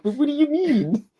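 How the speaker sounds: very high, loud mock-innocent voice; imitated puzzled look. Putting words to his baffled look, squeaky imitation. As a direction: squeak it out as a comic baffled question. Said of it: "He just looks at you like".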